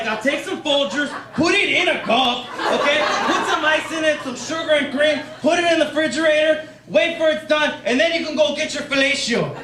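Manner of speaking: high, insistent father impression; lecturing dad. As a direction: list instructions as an exasperated dad. Dad dictating his coffee recipe.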